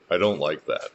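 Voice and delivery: preacher corrects himself, dry and low; corrected disapproval. Shown as a demonstration. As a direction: take it back soberly. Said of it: "Or should I say".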